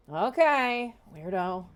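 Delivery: loud, high dismissive okay; scoffing. Acted. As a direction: brush her off as odd. Giant scoffs back.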